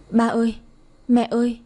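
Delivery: put-on a mild, understated wail of a small boy; crying child wail. A narrator's reading. Orphaned boy cries for his dead parents, mild wail. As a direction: let a hint of tears break through the call. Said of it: "gào lên khóc".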